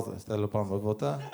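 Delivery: "Te lo pa me volta" dropped low and soft, rising on "volta"; smooth-talking pickup line.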